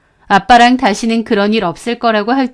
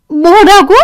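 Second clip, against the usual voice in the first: high, loud startled cry; shocked teacher; 8 semitones higher, 7 dB louder, slower than usual. Retold or imitated. Teacher hearing about the father, shocked high cry. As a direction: gasp it out in shock.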